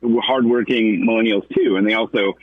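normal talking voice

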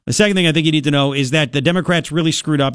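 ordinary talk

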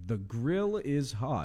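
slow, announcer-style promo read. Pretend. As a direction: read it like a radio show intro. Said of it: "trying to become the voice of The Tailgate".

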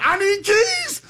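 high-pitched panicked outburst; mock frantic squeal; imitated frantic mother. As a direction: burst in hollering, pitch shooting up. Retold mama bursting into room, frantic.

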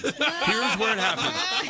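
slow laughing mock dread. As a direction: stretch it out, laughing at your doom.

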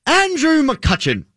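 slow, high and drawn out in a posh British accent; the first to try the name in a British accent, slow and high. Shown as a demonstration. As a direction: stretch the name out in your best British accent. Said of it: "say Andrew McCutcheon in their best British accent".

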